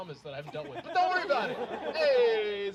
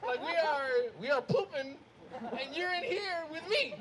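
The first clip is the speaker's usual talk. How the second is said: outraged; 9 semitones higher, 4 dB louder, slower than usual. Retold or imitated. Arguing with dad on toilet.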